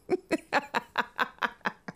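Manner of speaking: quiet breathy laughter; hushed giggle; soft laugh. Real, not acted. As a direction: laugh softly to yourself. Cracking up at comic.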